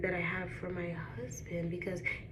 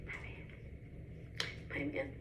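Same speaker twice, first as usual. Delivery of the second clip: higher, slow and drawn out; adoring, drawn out; 7 semitones higher, much slower than usual. Real, not acted. Gushing to viewers about her Lord and Savior, adoring. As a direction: savor it like a love song.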